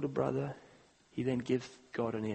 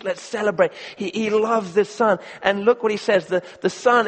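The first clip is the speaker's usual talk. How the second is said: rising, louder preaching build; 3 semitones higher, 9 dB louder than usual. Acted. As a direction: drive it home to the congregation. Preaching the father's feast.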